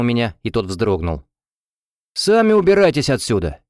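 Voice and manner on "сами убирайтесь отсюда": high, heated comeback; aggressive retort. A narrator's reading. Student swings at the defenders, aggressive. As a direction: throw the order back, ready to hit. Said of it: "замахнулся боковой".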